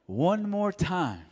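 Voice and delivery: high, loud, slow warning to the nurse; a patient fed up with being shoved around, warning loudly. Retold or imitated. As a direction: bark it out slowly, word by word. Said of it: "and I said it loud".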